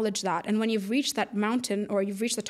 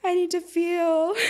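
pleading quote; 8 semitones higher, 4 dB louder, slower than usual. Retold or imitated. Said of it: "And I'm like".

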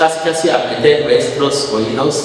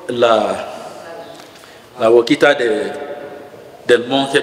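ordinary talk

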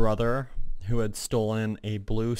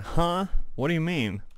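higher-pitched imitation of a baffled character; mock puzzlement; 7 semitones higher, 3 dB louder than usual. Gamer voicing the game hero told to follow someone, in mock bafflement. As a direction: act out his confusion in a raised pitch. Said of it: "He's just like".

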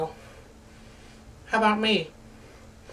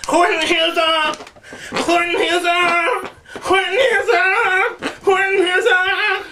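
much higher, slow wailing repeats; mock anguished cry; 10 semitones higher, slower than usual. Pushing through the joke title, hammed-up wailing. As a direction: wail it like it hurts. Comic pained cries.

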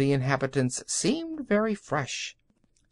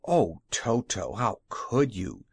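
a gentle, dismayed reproach; mild distress at her dog; 4 semitones lower, slower than usual. A narrator's reading. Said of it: "exclaimed Dorothy, much distressed".

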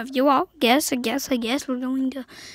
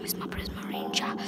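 sneaky whisper; 15 dB quieter than usual. Real, not acted. Gamer sneaking up on a player, whispering. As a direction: breathe it out so nobody hears.

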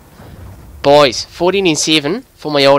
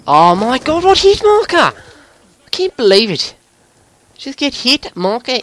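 incredulous outburst; 8 semitones higher than usual. Real, not acted. Gamer protesting a hit marker, incredulous. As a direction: let the voice shoot up in protest.

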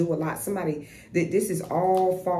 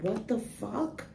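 slow, higher, stretched-out what the fuck in a put-on voice; drawn-out mock disbelief; 5 semitones higher, 6 dB quieter, much slower than usual. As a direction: drag it out like someone stunned by the backfire. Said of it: "They're like".

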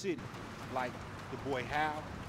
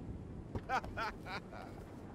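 hearty laugh; 6 semitones higher, 5 dB quieter than usual. Acted. Greets new partner with laughter.

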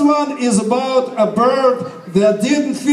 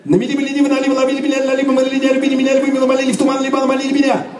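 very fast; much faster than usual. Shown as a demonstration. Teacher showing off the fast version to the audience. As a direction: rattle it off at top speed. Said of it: "say this tongue twister very, very fast".

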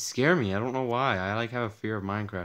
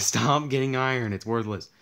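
imitating annoyed viewers; 4 semitones higher, 8 dB louder than usual. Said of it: "You guys are probably like".

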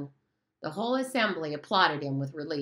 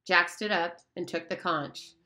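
ordinary delivery

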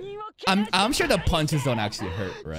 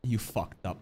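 normal talking voice

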